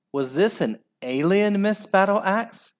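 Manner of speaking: ordinary delivery, nothing marked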